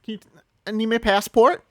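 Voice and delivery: a terrible attempt at a Derry accent. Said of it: "My terrible attempt at her Derry accent".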